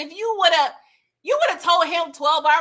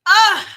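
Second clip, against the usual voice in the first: shocked yelp; 8 semitones higher, 11 dB louder than usual. Real, not acted. Reacting to shocking scene.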